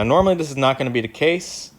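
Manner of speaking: usual speaking voice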